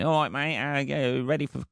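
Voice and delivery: high-pitched parody of neighbourly chat; mock small talk. Eight years of neighbour chit-chat, high mock-friendly voice. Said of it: "a fucking conversation of going".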